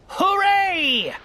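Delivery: a big triumphant cry of hooray; king crowing from his throne; shout. A narrator's reading. King on the turtle stack crows to the sky, triumphant shout. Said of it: "shouted Yertle".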